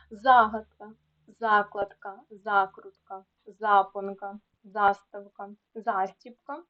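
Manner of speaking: teacher's list from Загадка to застібка with initial stress; stress on first syllable. Shown as a demonstration. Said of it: "наголос на першому складі".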